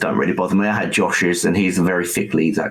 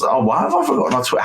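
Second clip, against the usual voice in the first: much higher, fast burst of surprise; surprised exclamation; 12 semitones higher, faster than usual. Real, not acted. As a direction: blurt it out as you catch your own slip. Suddenly realises he forgot Twitter.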